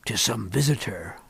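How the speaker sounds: low, soft muttering to himself; muttered. Acted. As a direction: mutter it under your breath. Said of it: "I muttered".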